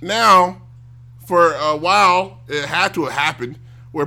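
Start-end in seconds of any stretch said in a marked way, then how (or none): none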